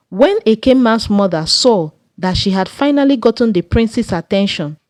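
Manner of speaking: usual speaking voice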